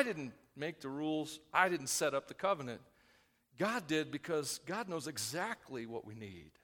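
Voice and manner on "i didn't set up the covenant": normal talking voice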